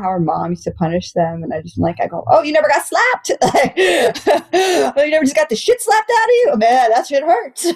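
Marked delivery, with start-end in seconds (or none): 2.3-7.8 high, mock-astonished, laughing; laughing disbelief. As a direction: tease her through laughter. Mocking her upbringing, laughing.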